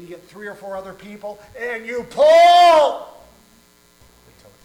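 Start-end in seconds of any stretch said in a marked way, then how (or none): 1.6-3.2 long, loud drawn-out pull; straining pull. Acted. Preacher acting out pulling a calf free, loud strain. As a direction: heave the word out with effort.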